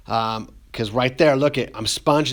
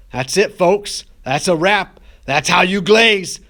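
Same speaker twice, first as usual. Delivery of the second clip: upbeat sign-off; 5 semitones higher, 5 dB louder, slower than usual. Real, not acted.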